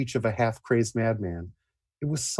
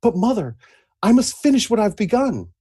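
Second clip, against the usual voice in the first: higher, louder heroic pleading; valiant protest; 12 semitones higher, 6 dB louder than usual. Acted. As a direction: push back bravely, raised voice. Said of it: "Valiantly, Astrid protested".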